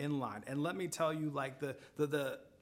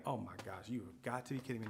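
exasperated aside; 6 semitones lower, 9 dB quieter, faster than usual. Retold or imitated. Retold exasperated private thought.

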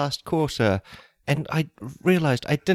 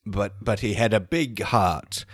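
lower, solemn funeral address; mock eulogy; 7 semitones lower than usual. Woman eulogizes the orphan boy alone, lower and solemn. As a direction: speak like a lonely mourner at an empty service.

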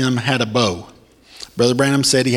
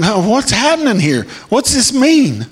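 higher, puzzled questioning; bewildered churchgoer wondering aloud; confused listener; 9 semitones higher than usual. Retold or imitated. Preacher voicing a baffled reader of prophecy, puzzled.

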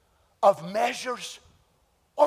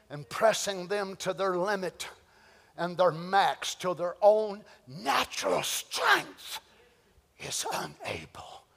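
lower, sinking from voice into a whisper; slow, hushed fade; fading whisper; 7 semitones lower, 8 dB quieter, slower than usual. Acted. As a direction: let the strength drain from the words. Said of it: "dropping to a whisper".

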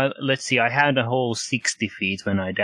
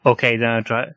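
ordinary talk, nothing marked

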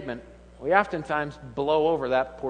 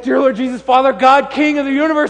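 mock grand prayer; 8 semitones higher, 11 dB louder than usual. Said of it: "you're like".

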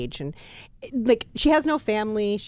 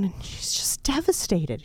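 ordinary delivery

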